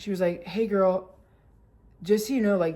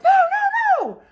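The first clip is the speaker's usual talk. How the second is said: very high, loud mock wail; panicked; 7 dB louder, slower than usual. Daughter grabbing the squatting dog, hysterical panic. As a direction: squeal in hysterical protest.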